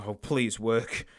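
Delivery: quiet, slow begging; pleading softly. Real, not acted. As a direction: beg the dead pen to come back to life. Begging the pen to work.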